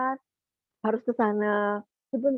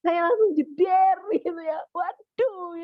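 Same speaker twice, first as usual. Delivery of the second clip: high-pitched startled cry; jolted as if caught out, pitch shooting up; startled exclaim; 8 semitones higher, slower than usual. Retold or imitated. Struck by God's rebuke, exclaims.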